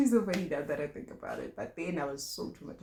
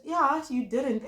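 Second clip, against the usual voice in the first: slow, higher, louder retort; pointed yeah; 8 semitones higher, 8 dB louder, slower than usual. Retold or imitated. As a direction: drag it out pointedly. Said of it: "I said".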